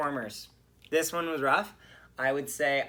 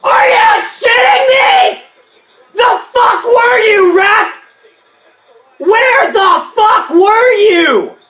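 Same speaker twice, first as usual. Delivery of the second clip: much higher, much louder, slow furious shouting at the ref; reliving a furious rant; 22 dB louder, slower than usual. Retold or imitated. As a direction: scream at the referee over a missed call. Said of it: "Okay, so I'm heated".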